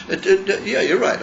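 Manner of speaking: real laugh while conceding the point. Real, not acted. Laughing as he concedes to his study partner.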